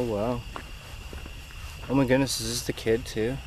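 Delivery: ordinary delivery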